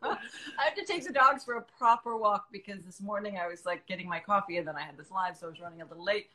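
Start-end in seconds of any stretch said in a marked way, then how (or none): 0.0-0.0 high, loud laughing words; amused at her own joke. Real, not acted. Laughing at sexy talk joke. 0.0-2.5 laughing. Real, not acted. Laughter fading.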